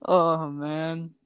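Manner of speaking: ordinary delivery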